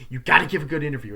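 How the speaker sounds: fast, loud, pushy order; bossy order. Retold or imitated. Reporter pushing her cameraman Kenny, fast loud bossy order. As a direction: rush him into getting the interview.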